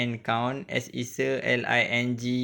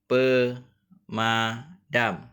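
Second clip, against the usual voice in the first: slow model word; much slower than usual. Shown as a demonstration. Teacher models the word slowly.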